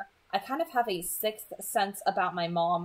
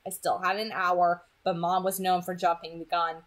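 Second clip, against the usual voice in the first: ordinary delivery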